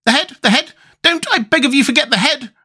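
very high, frantic pleading; excited cry. A narrator's reading. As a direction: beg urgently, pitch shooting up. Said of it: "cried the Wogglebug excitedly".

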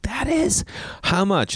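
high squeak of disbelief; high, startled question; shocked disbelief. Retold or imitated. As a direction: react like you can't believe it. Shocked by coral price.

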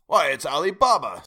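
high, loud cry of delight; ecstatic exclaim. A narrator's reading. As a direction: burst out in joyful recognition. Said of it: "Scrooge exclaimed in ecstasy".

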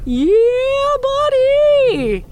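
high, loud drawn-out cheer; hyped yell. Acted. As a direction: belt it like a hype man. Hyped catchphrase shout.

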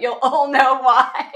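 loud, slow words through laughter; drawn-out, laughing punchline. Real, not acted. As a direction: break into a big laugh as you say it. Laughs at own accent joke.

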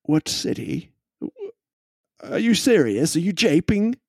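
incredulous gentleman. Acted. NPC incredulous.

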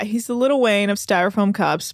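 usual speaking voice

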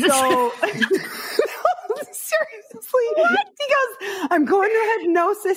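high, laughing through words. Real, not acted. Laughing as she tells the cohost his reply. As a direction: giggle through the telling. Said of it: "laughing while retelling".

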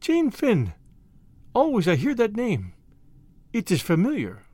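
half-remembering, French lilt; dreamy French girl. A narrator's reading. The maid muses over the familiar name.

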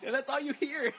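high-pitched, talking through laughter; laughing. Real, not acted. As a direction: crack up while telling it. Laughing at favorite gag.

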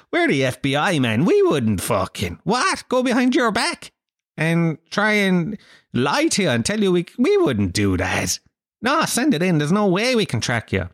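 lie with hammed-up wounded innocence; mock-innocent reassurance. FBI falsely reassuring the killer.

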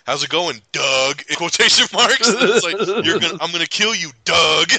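mock death threat through laughter; laughing mock threat. Host retelling the dad's threatening texts, laughing mock menace.

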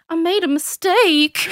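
put-on high, slow toddler wail; high little-kid voice, stretching the words; child's confession. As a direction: imitate a small child owning up, drawn out. Child repeats confession, acted out.

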